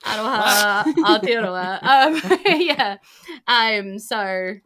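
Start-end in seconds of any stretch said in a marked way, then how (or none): none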